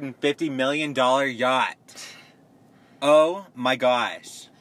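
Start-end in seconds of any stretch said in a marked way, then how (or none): 3.0-4.5 slow, drawn-out, louder gasp of disbelief; amazed. Real, not acted. Reacting to the yacht price with drawn-out amazement. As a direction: stretch it out in awe.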